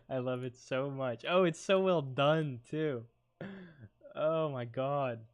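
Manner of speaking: excited gushing. Real, not acted. Gushing over a gift.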